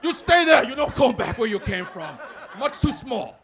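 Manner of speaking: loud, high, emphatic father voice; hammed-up shouting dad; dad impression. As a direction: bellow like an alarmed dad forbidding it. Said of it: "my dad's like".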